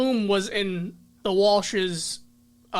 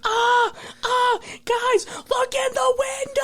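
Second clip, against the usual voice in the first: high panicked yell, much higher; excited shout; slower than usual. Retold or imitated. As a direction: shout it like a scared kid. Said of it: "there was a scene where he's like".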